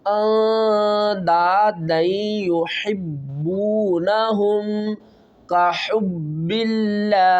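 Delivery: slow, measured tajweed recitation; Quran recitation. Shown as a demonstration. Teacher reciting the verse slowly for students. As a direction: chant each word with care for the students.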